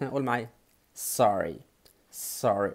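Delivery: ordinary talk, nothing marked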